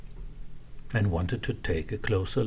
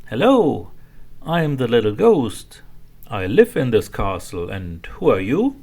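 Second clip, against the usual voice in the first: little ghost voice; 3 semitones higher, 7 dB louder than usual. Acted.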